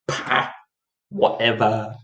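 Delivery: dismissive puff, low and soft; cold-hearted. Acted. Player ignoring the threat to the queen, cold-hearted. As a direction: toss it off with ruthless indifference. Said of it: "cold-hearted and ruthless".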